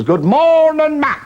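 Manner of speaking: high, loud mooing cow voice; talking cow. Acted. Cow answering the ventriloquist's greeting, mooed out. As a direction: moo the greeting out long and high. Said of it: "and the cow says".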